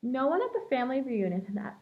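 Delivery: ordinary delivery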